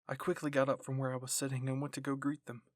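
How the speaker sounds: ordinary delivery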